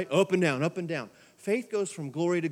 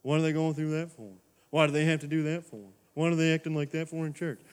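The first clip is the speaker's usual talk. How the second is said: judgmental churchgoer; 2 semitones lower than usual. Retold or imitated. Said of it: "if you're giving somebody a stink eye".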